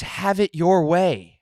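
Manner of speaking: loud, high, drawn-out slogan; indignant protest. Real, not acted. Customer defending his order to a scoffing friend, loud indignant protest. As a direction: hammer the motto out slowly and loudly. Throws the motto back in argument.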